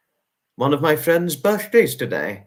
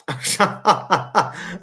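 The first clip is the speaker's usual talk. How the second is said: laugh; 5 semitones higher than usual. Real, not acted. Reacting to a viewer's joke.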